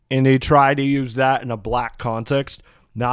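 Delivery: usual speaking voice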